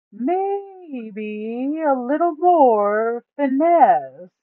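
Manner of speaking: high, loud, slow put-on voice for the greedy Duke. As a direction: drag the words out, high and loud, savouring the loot. Duke teases Kraxus.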